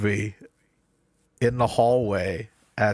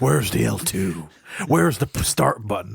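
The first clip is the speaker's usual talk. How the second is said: mock Batman voice. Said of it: "just yelling".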